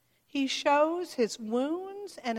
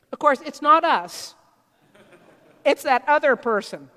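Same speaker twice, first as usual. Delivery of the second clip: much louder mock-innocent irony; ironic aside; 10 dB louder than usual. Real, not acted. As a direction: point at the other guy, loud and teasing.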